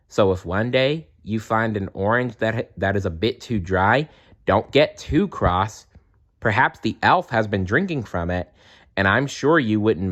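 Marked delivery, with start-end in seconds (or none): none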